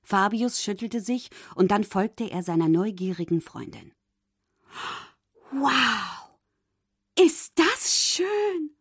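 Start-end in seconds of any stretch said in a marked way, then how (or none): 5.5-8.8 high, slow, wonder-struck mermaid voice; delighted cry. A narrator's reading. Mermaid discovering the shipwreck, high and amazed. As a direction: drawl out the wonder of it. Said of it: "rief Ariel".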